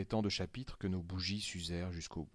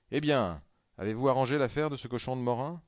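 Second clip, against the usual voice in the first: loud and higher calling out; crowd shouting; 5 semitones higher, 7 dB louder than usual. A narrator's reading. Waiting crowd calling out to the travellers, loud shout. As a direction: shout the question across the station. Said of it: "On cria".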